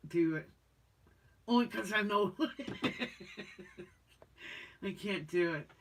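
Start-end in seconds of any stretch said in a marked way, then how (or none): 2.4-3.8 high, quiet giggle; breathy little giggle; soft laugh. Real, not acted. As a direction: laugh it off softly. Laughs about cracklings.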